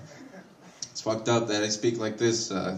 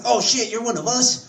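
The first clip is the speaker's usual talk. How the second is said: much higher, delighted surprise; surprised coworker; 11 semitones higher than usual. Retold or imitated. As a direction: realize he's one of you. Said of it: "He's like".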